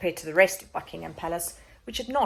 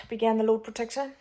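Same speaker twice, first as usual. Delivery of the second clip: usual speaking voice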